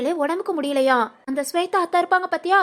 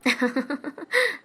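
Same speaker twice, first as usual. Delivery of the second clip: soft, pleased laugh; amused chuckle; 5 dB quieter than usual. Acted. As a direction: chuckle quietly at his answer. Grandmother amused by his reply.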